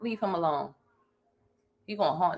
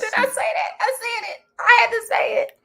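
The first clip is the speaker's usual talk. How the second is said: high, laughing cry of shock at herself; half-laughing, half-shrieking confession; laughing disbelief; 3 dB louder than usual. Real, not acted. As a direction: squeal through laughter as if caught out. Shocked at own bold remark.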